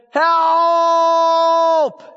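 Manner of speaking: long, high-pitched call for help; preacher demonstrating a drawn-out cry. Shown as a demonstration. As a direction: stretch the word into one sustained high cry. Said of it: "cry out".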